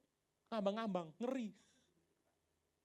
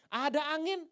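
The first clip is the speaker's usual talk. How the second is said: ordinary talk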